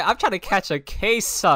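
mocking quote. Teasing the guest about his slip, loud mocking quote. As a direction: throw his words back at him loud. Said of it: "He said".

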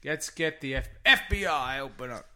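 loud, barked raid call; mock FBI raid. To her friend, pretending the FBI storms in over socks, a mock shout. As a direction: pound the door as a pretend agent.

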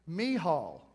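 "Michal" pronounced mee-HALL; correct pronunciation. Shown as a demonstration. Preacher repeating the newly learned name, "Michal" as mee-HALL. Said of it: "I found out how to say it".

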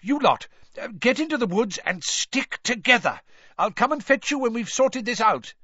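high, loud urgent orders; father ordering his children into the woods, high and loud; shouting orders. A narrator's reading. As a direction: send the kids off over the noise. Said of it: "Mister Weasley shouted over all the noise".

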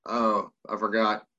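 ordinary talk, nothing marked

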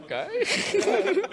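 high, laughing okay; laughing high-pitched okay; amused okay. Retold or imitated. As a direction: take it with a stunned laugh. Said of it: "And I'm like".

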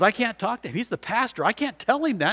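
high-pitched, fretful objection; pleading protest. Retold or imitated. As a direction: imitate a man balking, pitch up. Man protests confronting the pastor.

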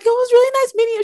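high, rapid daughter voice; high-pitched mock-polite farewell; imitated polite daughter. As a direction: chirp a quick goodbye in a high voice. Voices the daughter saying goodbye.

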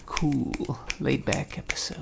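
hushed breathy whisper. Acted. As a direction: whisper it, laid back. Joking about laid back episode.

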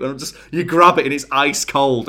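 ordinary talk

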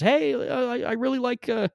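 high, chirpy clueless tourist voice; naive tourist. Retold or imitated. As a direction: play a wide-eyed Midwestern tourist gushing. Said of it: "people from Iowa and people from Saskatchewan".

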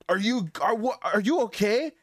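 high, stammering, amazed question; audibly excited friends. Retold or imitated. Friends checking on someone hit by a car, excited. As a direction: imitate friends freaking out in disbelief. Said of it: "as audibly excited as they were".

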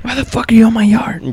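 high-pitched mock outrage; mock yell. Homeowner pretends to yell at kids in the yard, mock yell. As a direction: play an angry yard owner without real volume. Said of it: "get up over there and yell".

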